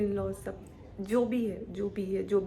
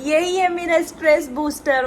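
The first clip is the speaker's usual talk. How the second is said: excited gush; 7 semitones higher, 12 dB louder than usual. Real, not acted. Mother playing with baby.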